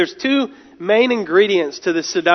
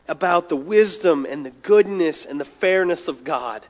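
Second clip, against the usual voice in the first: ordinary talk, nothing marked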